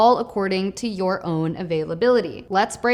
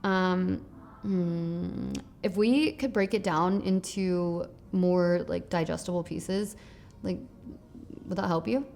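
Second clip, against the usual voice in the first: much softer, halting, with sighs and pauses; soft hesitant tone; 3 semitones lower, 8 dB quieter than usual. Shown as a demonstration. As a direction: drop your voice gently to meet a worried buyer. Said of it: "I'm stuttering, I'm pausing, I'm changing my tonality".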